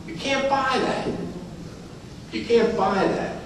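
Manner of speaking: tender, drawn-out quiet emphasis; heartfelt hush. Real, not acted. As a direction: say it slowly and softly, moved. Moved by granddaughter saying I love you.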